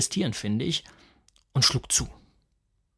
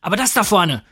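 higher, louder cry; shout; 6 semitones higher, 6 dB louder than usual. A narrator's reading. Husband spotting the mosquito, shouting. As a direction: call it out urgently. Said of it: "schrie ich".

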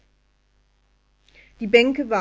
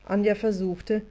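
ordinary delivery, nothing marked

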